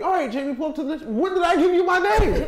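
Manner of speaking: high, loud chipper cashier turning to alarm; cashier voice, then shock. Retold or imitated. The cashier greets Jamie by name, chipper then alarmed. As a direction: chirp his name, then catch yourself. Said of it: "She'd be like".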